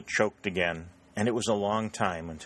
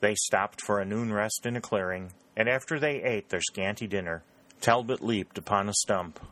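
usual speaking voice

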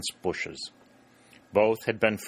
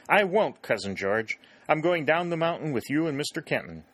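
indignant protest; 8 semitones higher than usual. A narrator's reading. Billy tells Cousin George he's coming, indignant. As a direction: insist hotly on going too. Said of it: "exclaimed Billy in violent indignation".

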